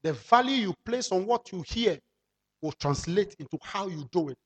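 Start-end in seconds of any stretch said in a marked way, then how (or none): none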